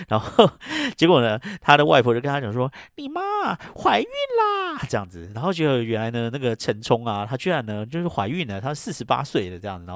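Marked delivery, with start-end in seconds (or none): 3.0-4.8 a startled cry of disbelief; shocked announcement. Retold or imitated. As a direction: blurt it out very high and loud. Grandmother breaks shocking news.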